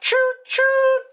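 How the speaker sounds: very high, whistling choo choo; squeaky toy-train whistle. Pretend. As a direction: toot it like a little train whistle. Gravy train joke.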